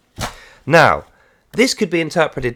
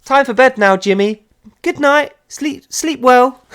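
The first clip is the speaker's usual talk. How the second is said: high, loud, sing-song mock sweetness; sugary bedtime parent; 9 semitones higher, 4 dB louder than usual. Parent tucking Jimmy into bed, high sugary mock coo. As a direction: coo him off to sleep, hammed-up.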